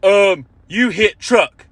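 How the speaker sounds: slow, dumb caveman voice; dim husband. Retold or imitated. Comic mocking the husband as a slow dumb brute. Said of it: "he was like".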